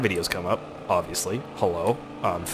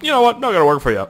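higher, louder put-on voice for a game; mock talking game; 9 semitones higher, 6 dB louder, faster than usual. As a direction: lightly imitate a game refusing to cooperate. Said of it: "The game was like".

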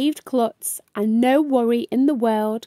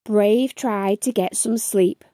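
ordinary delivery

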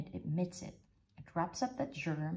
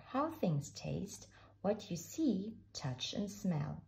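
plain reading voice

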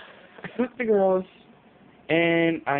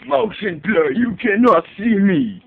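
slow, louder, higher mock gasp; mock amazed; 6 semitones higher, 7 dB louder than usual. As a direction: ham up the amazement.

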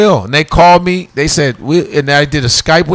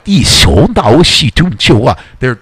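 ordinary delivery

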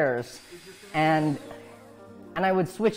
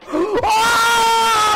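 sharp, high gasp of delight; loud excited gasp; 10 dB louder than usual. Real, not acted. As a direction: gasp in sudden triumph. Sees the fix working.

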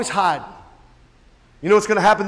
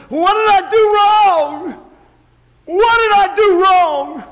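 loud, high, drawn-out mock wail; retold anguished weeping; 11 semitones higher, 7 dB louder, slower than usual. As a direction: cry out slowly like an anguished father. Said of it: "I wept to my boy and said".

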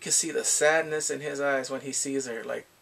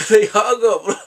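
loud, laughing delivery; amused outburst; 7 semitones higher, 16 dB louder, slower than usual. Real, not acted. Recapping the hug scene to viewers, laughing loudly. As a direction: burst out laughing through the words.

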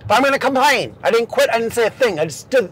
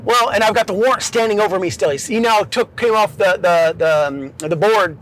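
ordinary delivery, nothing marked

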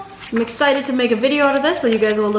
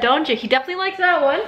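normal talking voice

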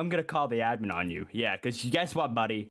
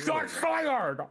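bigger mock callout; 13 semitones higher than usual. Joking to the police squad, a bigger mock cop yell. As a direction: push it up into a panicky mock alarm. Repeats callout, higher still.

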